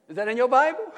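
loud, high rising question; amazed question. Acted. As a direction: challenge the congregation in astonishment. Exclaims to congregation over amazing verse.

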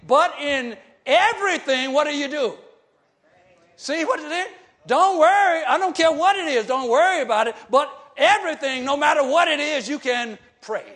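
loud, pounding preacher's exhortation; preaching at full force. Acted. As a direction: drive it home to the congregation.

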